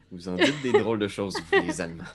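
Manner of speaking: loud, laughing aside; amused laughing. Acted. Edgar laughing at the Germans' crude words. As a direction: chuckle it out, taken aback.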